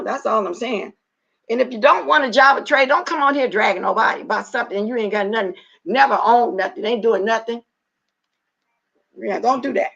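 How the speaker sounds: ordinary talk, nothing marked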